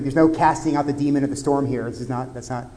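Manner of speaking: ordinary talk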